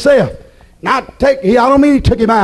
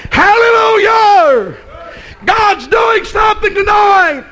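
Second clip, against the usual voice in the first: excited shout; 10 semitones higher, slower than usual. Real, not acted. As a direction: burst out in joy to the church. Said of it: "you don't have to get so excited about it".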